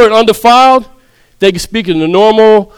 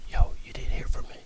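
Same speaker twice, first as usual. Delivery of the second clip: whispered secret gossip; conspiratorial whisper; 17 dB quieter than usual. Shown as a demonstration. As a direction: lean in and whisper it. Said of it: "now you whispering".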